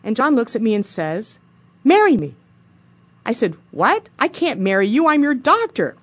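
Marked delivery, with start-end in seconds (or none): 1.8-2.3 blurted out high and loud, imitating a suitor; bold proposal. Retold blunt marriage proposal. 3.7-6.0 high, loud retold astonishment; incredulous refusal. Retold shocked refusal of proposal.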